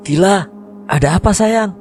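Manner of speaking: high-pitched worried call. A narrator's reading. Father outside to his unanswering daughter, high worried call. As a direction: call out high, stretching the end. Father calls through door.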